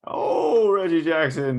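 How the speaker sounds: loud, high exclamation sliding down; excited cry. Real, not acted. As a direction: cry out in excitement at a big shot. Reacting to a big basket.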